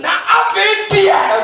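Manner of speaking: very slow, loud, high drawn-out call; fiery drawn-out sermon call; preaching shout. Acted. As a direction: stretch it out to rouse the church.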